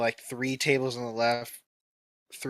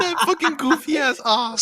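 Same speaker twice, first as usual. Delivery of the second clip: high, loud laughing goofy "ah"; goofy Peter Griffin ah; 13 semitones higher, 8 dB louder than usual. Retold or imitated. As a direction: laugh through a cartoon dad impression. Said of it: "the Peter Griffin fucking ah".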